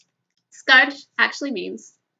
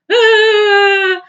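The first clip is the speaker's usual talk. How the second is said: high, loud startled ah; sudden dawning understanding; surprised realization; 9 semitones higher, 13 dB louder than usual. Retold or imitated. Teller's realization in story.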